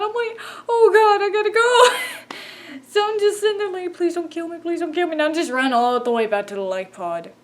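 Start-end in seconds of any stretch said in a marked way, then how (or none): 0.7-2.4 high, loud wail of panic; hammed-up frantic cry while fleeing; mock panic. Player retelling a flight in the seagull, wailing in mock panic. Acting out panicked escape in game. 3.9-5.1 high-pitched begging for mercy; mock pleading. Player retelling fleeing to the life pod, pleading in mock fear. Acting out pleading during escape.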